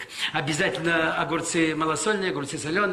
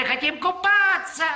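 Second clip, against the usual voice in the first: put-on high, slow whining kids; whiny children's cry; 14 semitones higher, 3 dB louder than usual. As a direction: wail it like impatient children. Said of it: "Дети кричали".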